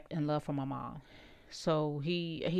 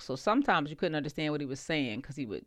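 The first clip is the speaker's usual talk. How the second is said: ordinary delivery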